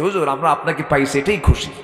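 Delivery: ordinary delivery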